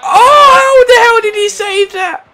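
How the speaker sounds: very high, loud cry of disbelief; disbelieving shout. Real, not acted. Gamer shocked by the keeper's save, high loud shout. Keeper saves his shot.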